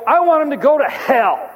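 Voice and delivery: put-on boy shouting his anger at his father; angry outburst. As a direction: blurt it out in childish rage. Angry boy tells dad.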